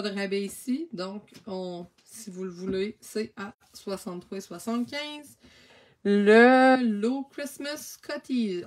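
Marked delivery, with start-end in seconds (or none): 6.0-8.6 put-on slow, loud, playful English accent on "Christmas Cottage"; a French speaker hamming up an English accent; practising English accent. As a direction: try out an English accent, stretching each word. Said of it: "je pratique mon accent anglais".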